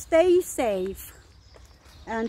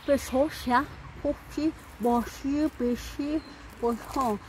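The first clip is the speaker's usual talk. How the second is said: mock French gibberish; 6 dB quieter than usual. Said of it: "French like this".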